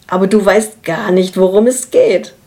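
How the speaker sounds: plain reading voice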